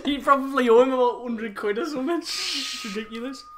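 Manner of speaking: laughing through the words; cracking up mid-sentence. Real, not acted. Laughing at friend's habit.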